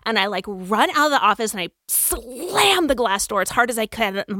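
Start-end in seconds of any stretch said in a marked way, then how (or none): none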